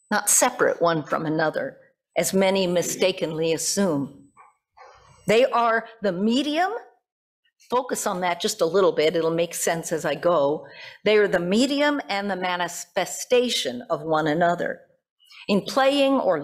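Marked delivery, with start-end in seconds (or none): none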